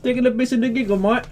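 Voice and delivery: high, loud mock Brit-Aussie accent; hammed-up heckling in a British accent; put-on British-Australian accent. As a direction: needle them in an over-the-top British-Australian accent. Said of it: "maybe a hybrid".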